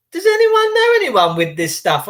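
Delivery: put-on high, worried old neighbour asking; elderly neighbour quoted. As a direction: ask it like a fretful elderly neighbour. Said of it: "they're saying to me".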